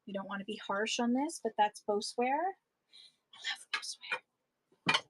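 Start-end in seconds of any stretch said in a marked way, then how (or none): 3.3-5.0 soft slow whisper of love for the pottery; whispered fond aside to viewers. Real, not acted. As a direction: breathe it like a secret confession. Aside about loving the pottery.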